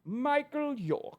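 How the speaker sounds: high, slow refined British; classy British accent. Acted. Delighted at the comparison, high British accent. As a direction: draw the name out in lofty British. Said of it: "You sound like Michael York".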